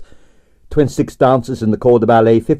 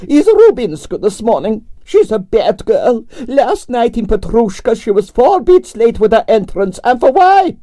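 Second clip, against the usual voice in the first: grande dame Russian ballerina; 12 semitones higher than usual. A narrator's reading. Narrator voicing Arienskaya, imperious and high. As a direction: sigh it out like a tragic prima donna.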